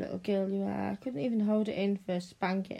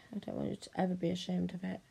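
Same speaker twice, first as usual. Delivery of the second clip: ordinary talk, nothing marked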